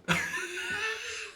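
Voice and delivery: quiet, high embarrassed laugh; soft laugh. Real, not acted. Vlogger laughing off the rude joke, soft and high. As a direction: giggle it off.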